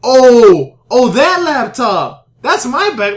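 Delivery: loud, drawn-out dawning "oh"; high, slow, exaggerated recognition; mock sudden realization. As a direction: imitate a friend belatedly catching on. Friend's belated realization, acted out.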